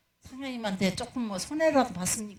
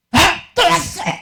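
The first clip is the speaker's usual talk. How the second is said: mock angry yell; 8 semitones higher, 9 dB louder, slower than usual. Angry shop owner retold.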